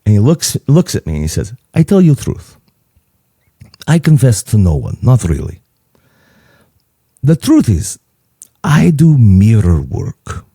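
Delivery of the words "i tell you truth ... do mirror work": grave imitated Greek, long pauses; serious Greek accent. Father-in-law admits his private practice, serious Greek accent. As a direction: confess solemnly in a put-on Greek accent. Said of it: "he gets real serious".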